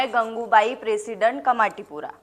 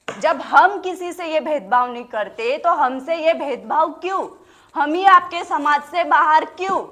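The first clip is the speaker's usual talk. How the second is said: loud, raised rhetorical questions; indignant protest; 4 semitones higher, 4 dB louder than usual. Acted. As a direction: fire the questions at the whole crowd. Protests exclusion from society at rally.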